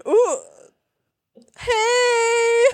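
high startled ooh, hey; startled yelp. Retold or imitated. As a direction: caught off guard, squeak it out.

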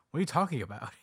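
whispered, half-laughing quote; mock whisper. Retelling the scene, mock whisper. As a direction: hiss it like an aside. Mocking the girl's offer, whispered.

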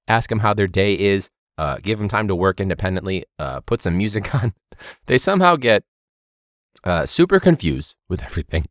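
ordinary talk

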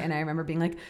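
ordinary talk, nothing marked

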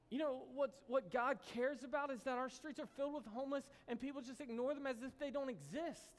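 rally the church, building intensity; preaching at full force. Acted. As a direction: pastor urging the congregation, fervent. Preaching climax.

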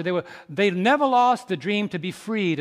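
normal talking voice

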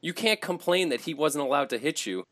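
high-pitched, incredulous protest; worked-up, indignant high voice; exasperated rant. Real, not acted. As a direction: argue it in exasperated disbelief. Ranting about the player's hypocrisy.

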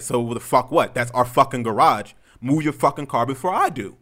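loud, forceful confrontation; heated re-enacted warning; retold angry threat. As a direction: lay down an ultimatum, hitting the swear words. Retold argument over the garage.

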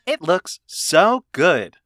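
slow, flat robot woman reading; TikTok robot voice. Pretend. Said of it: "I'm trying to do the TikTok robot woman voice".

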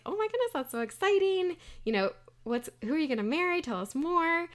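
high-pitched gushing delight; acted excitement. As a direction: gush like a thrilled family begging for details. Family thrilled by engagement news.

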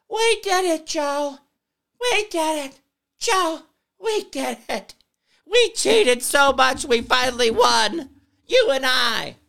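high, loud giddy mock cheer; mock Kamala gloating. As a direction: squeal it like a giddy cheater crowing to Joe. Kamala's phone call acted out.

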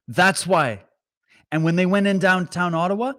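ordinary talk, nothing marked

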